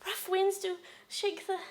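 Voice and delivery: high, small, softened voice; faltering tearful recitation. Acted. Wife failing to finish sonnet, soft tearful faltering. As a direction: falter and lose the verse.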